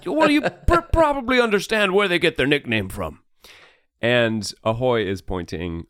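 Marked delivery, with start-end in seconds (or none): 0.1-3.2 high, laughing sailor woman; amused high character voice; Betty's voice. Acted.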